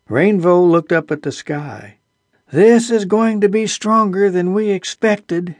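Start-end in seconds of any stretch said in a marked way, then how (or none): 2.5-5.5 called out higher over the wind; calling out. A narrator's reading. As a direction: call back over the storm. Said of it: "he called back".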